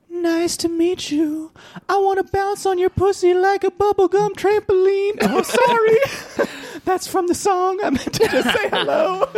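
[name] imitation. Singer greeting someone with lewd lyrics, apologising, falsetto impression. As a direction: say everything in a singer's high falsetto. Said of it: "he'll walk up to someone and he'll be like".

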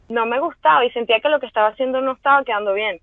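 ordinary delivery

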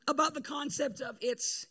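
ordinary talk

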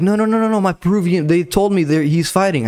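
high, eager insisting; insistent fan. Retold or imitated. Pharmacy lady insists her fighter's on.

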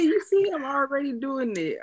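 bragging to a friend; excited brag. Retold or imitated. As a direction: crow about it playfully. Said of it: "I'm like".

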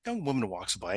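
usual speaking voice

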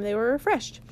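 ordinary delivery

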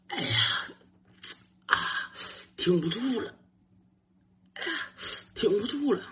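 high, soft strained groaning; straining to hold it. Retold or imitated. Boy bursting for the toilet in a bathhouse, straining. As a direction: squeeze the words out, desperate to hold on. Acting out urgent need, straining.

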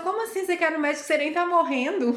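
high-pitched, disbelieving retort; mock incredulity. Friend scoffing at her wish to see a doctor, mock incredulous.

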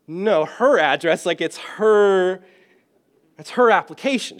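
high, loud and slow, stressing her; mock patient exasperation; exasperated correction. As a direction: spell it out slowly to the confused man. Exasperated correcting old man.

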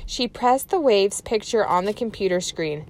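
ordinary delivery, nothing marked

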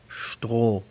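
Stroh with a long O and silent h. Shown as a demonstration. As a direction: hold the O of Stroh. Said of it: "Escuchamos una O larga".

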